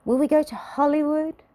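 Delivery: high, eager character voice; a high excited little girl asking; high little star voice. A narrator's reading. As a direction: ask it in a bright high voice. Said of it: "asked Little Miss Star".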